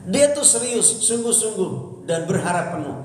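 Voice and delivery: high, pushed emphasis; preacher stressing seriousness, high and emphatic. Acted.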